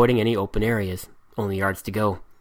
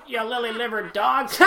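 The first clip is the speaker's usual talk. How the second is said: much higher, giggling mock insult; laughing mimicry; 12 semitones higher, 5 dB quieter than usual. A narrator's reading. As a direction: parrot the captain while laughing.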